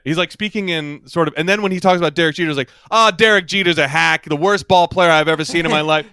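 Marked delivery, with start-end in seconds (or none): 2.9-6.1 loud, fluent American sports-talk rant; sports-radio rant. Retold or imitated. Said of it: "now you sound like Colin Cowherd".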